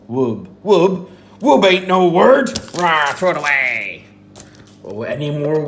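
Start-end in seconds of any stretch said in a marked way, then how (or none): 0.1-4.0 loud high scornful pirate; scornful pirate shout. Pretend. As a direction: bellow that it's no word. Pirate rejects silly word.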